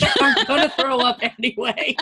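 laughing while finishing the line. Real, not acted. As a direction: let the laugh spill into it. Laughing at own story.

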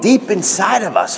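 ordinary talk, nothing marked

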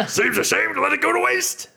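high rough orc voice; gruff half-orc voice; Ront voice. Acted. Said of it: "these voices are really taking a toll".

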